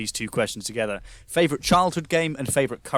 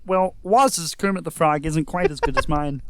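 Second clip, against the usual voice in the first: light Kermit voice, a bit higher; hint of a frog puppet; 4 semitones higher, 3 dB louder, slower than usual. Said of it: "I do a good Kermit the Frog impression".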